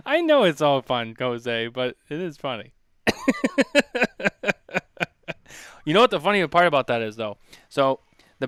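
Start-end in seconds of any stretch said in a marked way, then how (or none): 3.0-5.0 high burst of laughter; laughing. Real, not acted. Laughing while telling Jose it's all fun.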